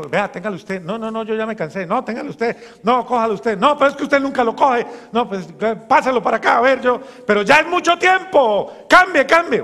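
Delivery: bickering that climbs into a shouting match; squabbling siblings. Acted. Grown children arguing over their old parent, building from bickering to yelling.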